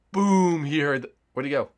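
usual speaking voice

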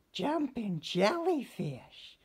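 high, slow little-fish exclamation; drawn-out mock dismay from a tiny fish; Stanley realises he's late, high and slow; little fish groaning. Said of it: "he groaned".

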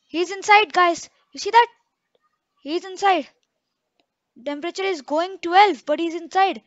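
alarmed by the ghost inside; scared shout. Real, not acted. Ghost appears again.

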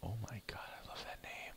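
soft unvoiced whisper; whispered delight. Real, not acted. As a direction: breathe it out in amused awe. Delighted reaction to funny name.

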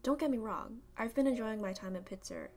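ordinary talk

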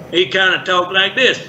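put-on Southern character voice; Southern accent. Shown as a demonstration. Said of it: "a Southern voice".

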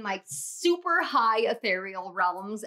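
normal talking voice

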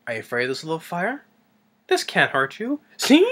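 mock taunt rising in pitch; taunting guard. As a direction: imitate needling with a climbing, singsong tease. Guard taunting monster with a flame.

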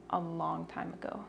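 whispered aside; hushed confession. Real, not acted. Aside about old kimchi.

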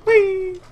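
high, held squeal of "whee"; playful whee. Pretend. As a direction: let out a loud, high whee. Playful cry jumping past lava.

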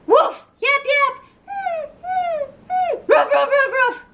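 dog barks. Pretend. Counting dogs, barking sounds.

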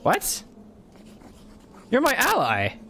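high-pitched outraged wail; crying with rage. Real, not acted. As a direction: shriek in betrayed disbelief. Betrayed by ally, outraged.

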